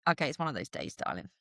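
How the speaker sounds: dropping to a hushed, near-whispered murmur; soft self-talk. Retold or imitated. Telling herself it's one of those days, hushed and gentle. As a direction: soothe yourself gently, almost whispering. Comforting herself on a bad day.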